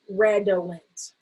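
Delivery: redolent slowly as red-o-lent; slow, carefully sounded red-o-lent; full syllables. Shown as a demonstration. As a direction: say redolent with each vowel full. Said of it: "my vowel is short".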